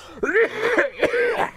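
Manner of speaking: hacking cough, high and strained; rough cough. Acted. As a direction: cough it out harshly. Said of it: "it got him coughing again".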